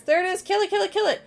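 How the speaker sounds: high, loud terrified screaming; panicked shout. Acted.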